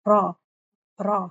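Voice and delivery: را with a single tongue roll; single roll raa. Shown as a demonstration. Teacher answers how to read را correctly. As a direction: tap the r of را once. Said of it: "رول ہو رہی ہے نا میری زبان".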